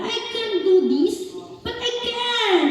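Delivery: put-on high, small defiant kid voice; defiant child. Boss's little nephew talking back, high child voice. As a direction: pipe it up like a stubborn little boy. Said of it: "Sabi niya".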